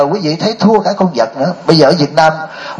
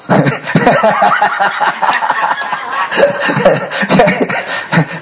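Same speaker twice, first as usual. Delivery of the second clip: real laugh; 6 semitones higher than usual. Real, not acted. Laughs at his own story.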